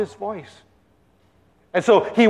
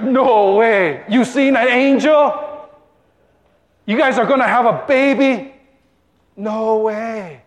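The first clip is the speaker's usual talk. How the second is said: higher-pitched incredulous questions; amazed crowd; 4 semitones higher than usual. Acted. People guessing Zechariah's mimed news, amazed. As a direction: react in comic astonishment.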